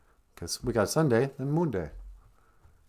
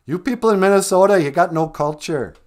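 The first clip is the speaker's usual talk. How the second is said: loud, higher-pitched accusing complaint; mock scolding; 6 semitones higher, 8 dB louder than usual. Food snob berating people in Minnesota, mock scolding. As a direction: scold them loudly, hammed-up.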